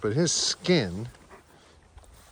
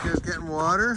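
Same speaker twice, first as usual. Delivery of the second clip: usual speaking voice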